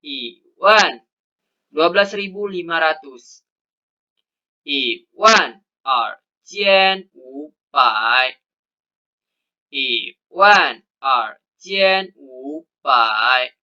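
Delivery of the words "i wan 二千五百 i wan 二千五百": I wan 二千五百 read very slowly, wan lifted at the end; slow model reading. Shown as a demonstration. Teacher modelling twelve thousand five hundred, slow and deliberate. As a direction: spell it out slowly for learners.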